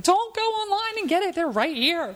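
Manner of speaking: very high-pitched mock protest; worked-up, high outcry; exasperated plea. Acting out plea to online buyers.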